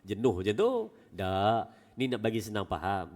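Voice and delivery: ordinary talk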